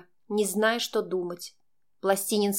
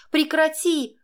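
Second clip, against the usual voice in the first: high, raised-voice plea to stop; hysterical cry; 8 semitones higher, 4 dB louder, slower than usual. A narrator's reading. Girl crying out under Max's weight, hysterical cry. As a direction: cry out in mounting panic. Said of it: "закричала я истерично".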